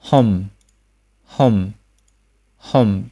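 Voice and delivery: "hum" repeated singly, falling into a closed-lip m; isolated careful "hum", humming finish; final m demonstration. Shown as a demonstration. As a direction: seal the lips at the end of each "hum". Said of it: "后加收音".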